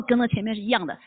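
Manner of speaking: usual speaking voice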